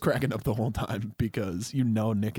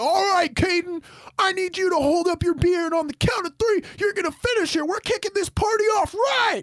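high, shouted stage command; hammed-up country star firing up the party; 4 dB louder than usual. Singer telling a fan to finish his beer, high stage shout. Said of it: "I, I'm [name]".

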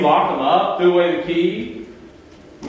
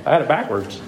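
laughing at himself; 5 semitones lower, 4 dB quieter, faster than usual. Real, not acted. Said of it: "I can't even talk".

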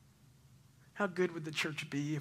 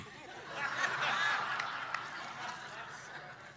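airy laugh, higher; breathy high laugh; 10 semitones higher, 4 dB quieter than usual. Real, not acted. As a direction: silent shaking laugh. Laughing at own story.

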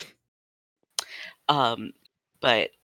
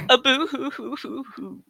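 fake crying; 10 semitones higher than usual. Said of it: "he's just doing a boo-hoo-hoo".

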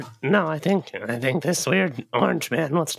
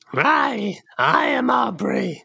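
lightly put-on, higher introduction; posing as Aubrey; 7 semitones higher, much slower than usual. Gnome claiming to be Aubrey, lightly hammed-up higher voice. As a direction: draw out the false introduction.

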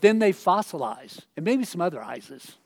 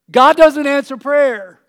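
shouted slowly and higher; loud declaration; 8 semitones higher, 14 dB louder, slower than usual. Acted. Preacher shouts the daughter's conclusion, loud and slow. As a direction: proclaim it to the whole room.

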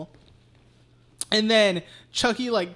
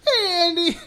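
high, loud, put-on singsong hello; mock cheery greeting; 11 semitones higher, 5 dB louder than usual. Darlene greeting Andy as she drives off, mock cheery. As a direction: call back blithely in a put-on voice.

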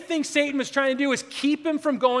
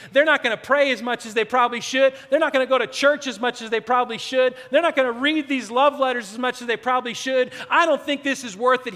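louder, driving sermon build; preaching at full force; 4 dB louder than usual. Real, not acted. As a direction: preach it hard, pushing each line.

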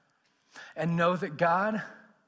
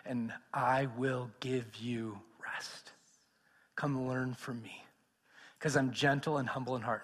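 tender invitation; 7 semitones lower, 6 dB quieter than usual. Acted. Preacher voicing Jesus' invitation to the weary, soft and tender. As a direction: offer it like a gentle hand.

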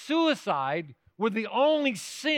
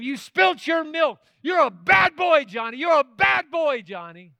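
angry dad yelling; 8 semitones higher, 6 dB louder than usual. Retold or imitated. Dad shouts at Johnny for spilling his milk, angry yell. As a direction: scold the child like a furious father. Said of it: "yelling at him".

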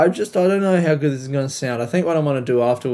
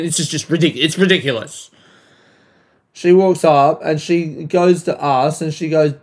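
ordinary talk

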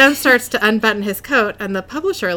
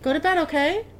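motherly coaxing; 7 semitones higher, 4 dB quieter than usual. Real, not acted. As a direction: gently send a little one to bed. Sending child back to bed.

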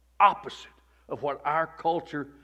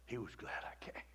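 soft whisper; 3 semitones lower, 17 dB quieter than usual. Acted. Whispered repeat.

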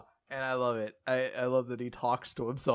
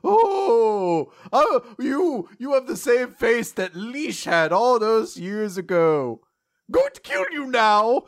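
high, loud, hammed-up devil; devil's gloating return; 8 semitones higher, 7 dB louder than usual. Host voicing the devil back and threatening, hammy villain. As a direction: exclaim with theatrical relish and menace. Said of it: "He's like".

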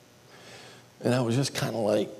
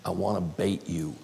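ordinary talk

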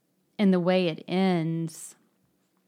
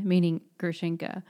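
usual speaking voice

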